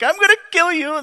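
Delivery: high, teasing sibling threat; playful brotherly threat. Shown as a demonstration. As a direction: toss it off like squabbling brothers. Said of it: "but not in a way like brothers do".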